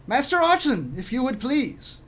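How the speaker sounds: high, lilting formal summons; high-pitched, projected servant's voice; servant summoning. Acted. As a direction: call the young master in politely. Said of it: "Kemed steps back ... and says".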